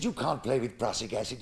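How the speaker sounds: deep, quiet churchman's voice; low vicar voice. Acted. Vicar questioning the little boy, low and quiet. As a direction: speak low and soft as the vicar.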